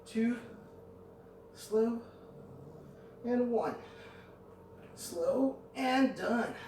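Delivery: breathless counting; counting while winded; out of breath. Real, not acted. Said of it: "I'm running out of breath".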